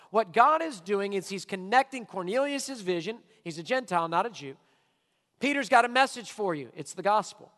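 usual speaking voice

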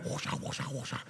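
fading breathy whoosha sounds; ultrasound whoosh. Retold or imitated. Imitating the ultrasound again for the congregation, fading whooshes. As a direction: mimic the machine, trailing off. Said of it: "put the machine on there and".

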